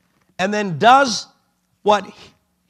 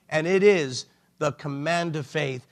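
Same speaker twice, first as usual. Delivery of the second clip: ordinary talk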